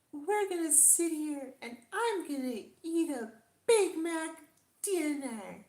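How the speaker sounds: high, loud sing-song influencer voice; fake bubbly voice. Shown as a demonstration. Vlogger parodying a fast food video, high chirpy voice. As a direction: sell a Big Mac like a phony vlogger. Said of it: "it was like".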